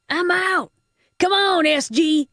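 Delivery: high-pitched, urgent calling out; agitated shout. A narrator's reading. Thug quitting and calling his partner, agitated shout. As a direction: call your partner away in a high voice.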